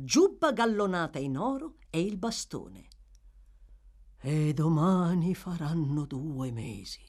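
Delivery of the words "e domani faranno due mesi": whispered with a sigh; murmured. A narrator's reading. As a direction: breathe it out on a sigh. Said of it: "mormorò il signor Bumble con un sospiro".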